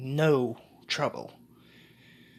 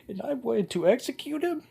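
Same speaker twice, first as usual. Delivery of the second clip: high-pitched indignant royal thought; Clover King; 6 semitones higher than usual. Acted. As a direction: act out a king's outraged disbelief. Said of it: "the Clover King thinks".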